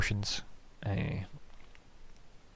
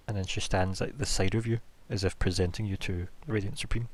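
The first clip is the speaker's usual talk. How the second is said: ordinary talk, nothing marked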